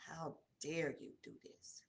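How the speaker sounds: a hint of a disgusted mother, whispered; mother's disgust. Daughter voicing her mother's silent look, a whispered rebuke. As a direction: breathe the judgment out, barely voiced. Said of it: "It was like she was just like".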